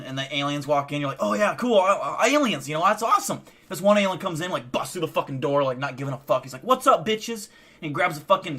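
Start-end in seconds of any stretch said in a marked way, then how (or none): 1.2-3.4 higher, louder giddy voice; mock excited. Voicing excited abductee. 6.6-7.5 higher, louder brash greeting; cocky alien. Retold or imitated.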